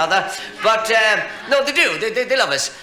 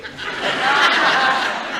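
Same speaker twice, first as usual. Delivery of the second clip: high laugh; 11 semitones higher than usual. Real, not acted. Laughs after punchline.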